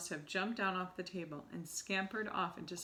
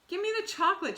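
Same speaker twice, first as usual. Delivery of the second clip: put-on very high, loud boy's shout; 13 semitones higher, 9 dB louder, faster than usual. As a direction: yell it, suddenly suspicious. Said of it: "shouted Bruno".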